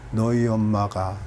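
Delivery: low, soft and slow, barely bothering; mock limp telling. Shown as a demonstration. Preacher mimicking a messenger telling a child news without joy, soft and slow. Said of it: "이렇게 말하겠어?".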